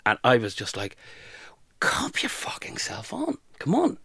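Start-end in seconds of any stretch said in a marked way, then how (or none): 1.8-4.0 high, strained, half-whispered telling-off; pent-up plea to pull yourself together; exasperated self-talk. Retold or imitated. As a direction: slowly urge yourself to get a grip. Voices stern talk to herself.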